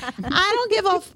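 high-pitched exasperated swearing; mock yell, pitched well up; retold screaming. Aunt turns round on nephew in car, mock screaming. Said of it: "That's why I'm screaming".

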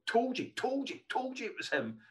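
mock Liverpool accent, high and loud; a triumphant Liverpool accent, gleefully retold. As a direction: crow it in a put-on Liverpool accent. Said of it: "I'm not doing the accent".

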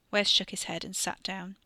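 ordinary delivery, nothing marked

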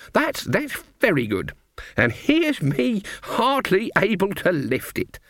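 high, feeble granny; helpless old lady. A narrator's reading. Granny pretends weakness to the woodcutter, high. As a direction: play the frail old dear.

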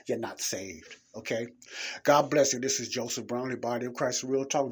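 ordinary delivery

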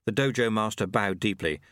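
plain reading voice

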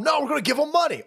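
chirpy fake reasoning; mock official.

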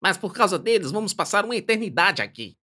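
high, thin grumbling voice; thin hoarse voice. Acted. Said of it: "com uma voz fina e rouca".

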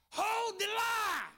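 loud, slow, shouted sermon lines; preaching at full force. Acted. Preacher rallying the faithful to hold the line, shouted. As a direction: drive each phrase home at the pulpit.

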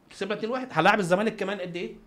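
ordinary talk, nothing marked

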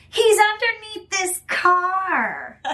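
high, slow lady voice; lady's voice. Retold or imitated. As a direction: call it out slowly, high like a helpful stranger. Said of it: "some lady goes".

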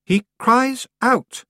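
high and very slow; deliberate repeat. A narrator's reading. Roland repeating himself to his aunt, slow and deliberate. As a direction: repeat it word by word, drawn out. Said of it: "Roland repeated".

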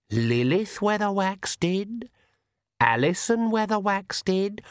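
very slow, eerie accusing voice; shadow voice. Acted. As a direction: drag out the accusation from the dark. Shadow voice names ancestors.

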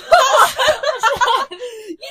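very loud, high, shrieking laugh; uproarious laughter. Real, not acted. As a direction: explode into laughter. Friend called a pug.

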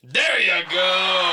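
slow, loud triumphant "there you go"; stretched celebratory cheer; excited shout. Real, not acted. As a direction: drag it out like a game-show win. Answer guessed correctly.

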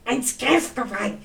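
lightly barked mock German words; a hint of hard German consonants. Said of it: "German's like".

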